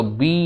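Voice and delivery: বি with its final "ee" vowel held long; drawn-out বি. Shown as a demonstration. Teacher showing pupils the vowel inside the letter, বি drawn out. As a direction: stretch the ending of বি into a clear "eee". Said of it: "ই আসছে তো উচ্চারণ করার পর".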